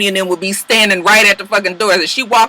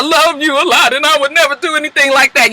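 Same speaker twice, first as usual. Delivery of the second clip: mock tearful plea; 7 semitones higher, 3 dB louder than usual. [name] crying one tear.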